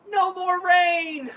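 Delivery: high, loud and very slow shout of relief; relieved yell about the rain; joyful shout. Retold or imitated. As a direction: stretch it out like a victory cry. Celebrating end of rain.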